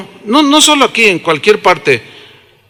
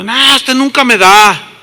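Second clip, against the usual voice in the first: higher, louder-edged mock protest; complaining child; 5 semitones higher, 4 dB louder than usual. Preacher as a boy answering his dad's refusal, complaining. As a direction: whine back at dad, pitched up.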